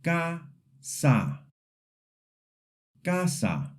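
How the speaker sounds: Casa with two open pure A sounds; Casa, open Spanish A in both syllables. Shown as a demonstration. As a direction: say Casa with clear open A vowels. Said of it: "Repeat after me".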